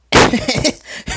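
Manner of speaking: loud high laugh. Real, not acted. Storyteller after a silly announcement, amused laugh. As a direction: burst out laughing at your own bit.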